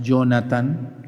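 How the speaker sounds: "Jonathan" slowly with a Y for the J; wrong Y sound. Shown as a demonstration. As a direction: say "Jonathan" as YO-na-tan, drawn out. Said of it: "La J no es Jonathan".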